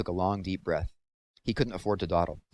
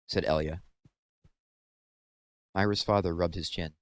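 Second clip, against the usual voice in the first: usual speaking voice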